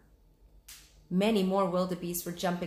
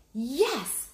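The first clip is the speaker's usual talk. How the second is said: cried out; 6 semitones higher, 3 dB louder than usual. Acted. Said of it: "cried Jack".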